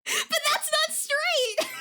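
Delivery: high, slow, loud protest; mock outrage. Streamer retelling friend's italic font idea, high indignant squeal. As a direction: squeal it like the font betrayed you.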